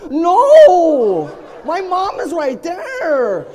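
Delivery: high, loud, drawn-out refusal; appalled protest. Retold or imitated. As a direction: wail in comic horror, stretching the words. Refusing to undress beside mom.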